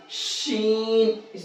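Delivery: شین soft and slow with a hissing whistle; whistled sheen. Shown as a demonstration. Teacher softly repeating a whistled شین. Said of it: "سیٹی نکال کر کے بولیں گے".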